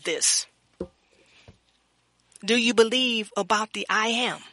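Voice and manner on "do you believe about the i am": ordinary talk, nothing marked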